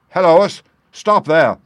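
loud high shout. A narrator's reading. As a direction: yell to stop them on the road. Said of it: "he shouted".

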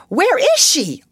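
very high, loud demand; almost shriek the question; near shriek. Acted. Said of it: "almost shrieked Jessie".

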